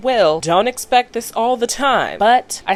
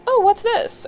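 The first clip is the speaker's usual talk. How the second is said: curious Lil; slower than usual. Retold or imitated. Lil finds the engraving.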